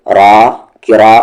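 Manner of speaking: ordinary delivery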